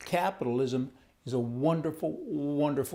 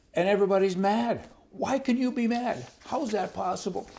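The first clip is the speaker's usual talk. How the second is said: incredulous exasperation; 8 semitones higher than usual. Real, not acted. Mocking opponents of the citizenship question, incredulous and higher. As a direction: throw up your hands at them.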